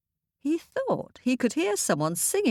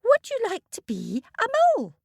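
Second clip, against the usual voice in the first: much higher small mole voice; a tiny creature speaking up; 4 semitones higher, faster than usual. Acted.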